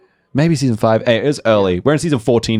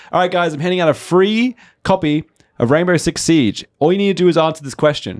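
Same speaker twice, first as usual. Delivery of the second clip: giveaway host; 7 semitones higher than usual. Retold or imitated. Host announces a giveaway to players.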